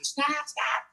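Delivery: high mild mock yell of a frightened mom. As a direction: squeal it high like mom on the downhill ride. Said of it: "my mom's yelling at me".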